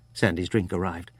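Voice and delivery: ordinary delivery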